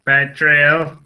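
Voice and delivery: loud, put-on "Petrol" in a sci-fi character voice; Doctor Who character. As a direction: declaim "Petrol" like a TV alien. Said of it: "You sound like a Doctor Who character".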